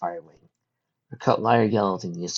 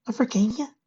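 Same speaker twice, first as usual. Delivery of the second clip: put-on young filly voice. Fireside's voice.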